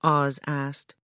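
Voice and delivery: ordinary delivery, nothing marked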